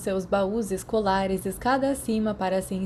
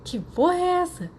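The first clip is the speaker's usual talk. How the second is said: shocked Sirius; 11 semitones higher than usual. A narrator's reading.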